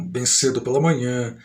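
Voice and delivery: ordinary delivery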